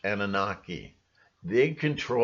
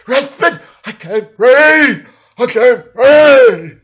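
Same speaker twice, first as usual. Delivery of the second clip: high, loud, slow hammed-up choking cry; mock breathless panic; mock panicked gasp; 12 semitones higher, 15 dB louder, slower than usual. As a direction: play a dying patient, over the top. Mock panicked virus victim.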